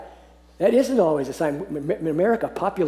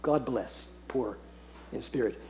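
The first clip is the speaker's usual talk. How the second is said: much softer and lower, gently bestowing the blessing; tender blessing; 5 semitones lower, 12 dB quieter than usual. Shown as a demonstration. Preacher imagines Jesus blessing the poor in spirit, softly and tenderly. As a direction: say it like a quiet benediction over someone hurting. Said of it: "Imagine when he says it".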